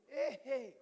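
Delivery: playful mock wonder; mock amazement. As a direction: feign being impressed. Exaggerated listening reaction.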